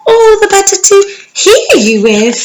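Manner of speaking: the wolf coaxing her closer; wolf purring sweetly as granny. Acted. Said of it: "the wolf said very sweetly".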